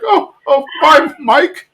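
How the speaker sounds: high, flustered mock goodbye; sheepish. Peggy caught sneaking out by Mike, sheepish high voice. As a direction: imitate an embarrassed, caught-out reply. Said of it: "So sheepish".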